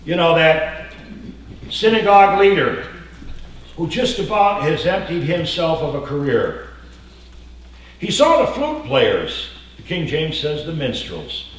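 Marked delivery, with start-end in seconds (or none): 0.0-6.7 loud, rising preacher's call; preaching at full force. Acted. Pastor preaching loudly to his church. 8.0-9.5 high, slow and loud; slow emphatic preaching. Acted. Pastor painting the funeral scene, slow and emphatic.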